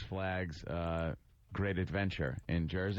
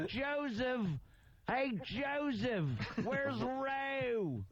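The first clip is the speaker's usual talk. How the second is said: high, slow call of Hey, Joseph; playful Sal impression, high and drawn out; much slower than usual. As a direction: imitate Sal calling the brother across the way. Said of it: "Sal says my brother's name".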